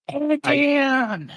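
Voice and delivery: high shrill cry of "Again!"; mock outraged shout. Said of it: "it makes him go".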